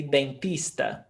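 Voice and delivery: dentista with a plain T, den-TEES-ta; hard T. Shown as a demonstration. Teacher quotes some Brazilian accents, hard T. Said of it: "with the tista, T T T".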